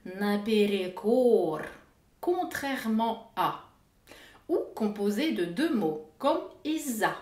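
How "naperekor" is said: naperekor said slowly, each syllable clear; slow demonstration. Shown as a demonstration. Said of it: "D'autres sont longues". (prononciation lente)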